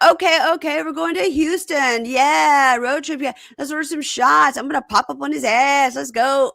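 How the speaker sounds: high-pitched mock party hype; hyped-up imitation. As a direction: imitate two friends pumping each other up before a road trip. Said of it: "pumping each other up".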